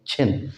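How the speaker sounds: छिन said with a breathy aspirated छ; aspirated chin. Shown as a demonstration. Teacher demonstrates the right छिन to students. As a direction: say छिन with a puff of air on the start. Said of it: "छे की आवाज़".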